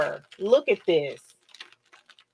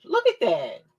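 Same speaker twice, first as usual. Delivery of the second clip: excited; 9 semitones higher, 4 dB louder than usual. Real, not acted. Excited over the shirt.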